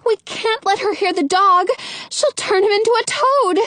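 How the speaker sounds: high, anxious plea; begging. A narrator's reading. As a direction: plead in a high girlish character voice. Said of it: "Rapunzel begs".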